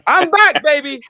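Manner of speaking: high, loud triumphant call; triumphant comeback. Real, not acted. Recovering on stream after being flustered, triumphant comeback. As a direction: announce yourself with a big lift in pitch.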